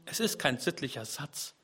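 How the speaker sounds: normal talking voice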